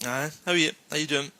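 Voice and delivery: quick Scottish "how are ye", run together; Scottish accent, said fast; fast northern greeting. Shown as a demonstration. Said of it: "Say it fast".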